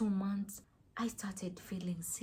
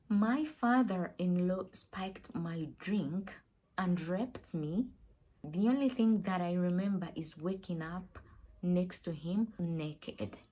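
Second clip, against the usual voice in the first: ordinary delivery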